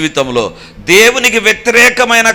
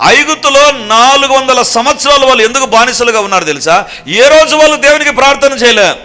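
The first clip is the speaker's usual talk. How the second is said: higher and louder, driving sermon delivery; fiery preaching; 6 semitones higher, 4 dB louder than usual. Acted. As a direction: preach it hard to the congregation. Preaching at full force.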